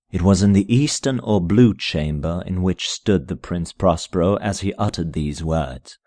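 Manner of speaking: usual speaking voice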